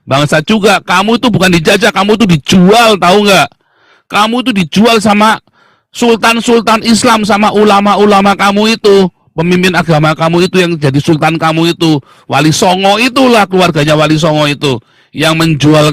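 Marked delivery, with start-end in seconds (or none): none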